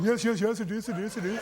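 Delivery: a hint of a dithering old gentleman; imitating Gielgud. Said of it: "he sort of speaks like that".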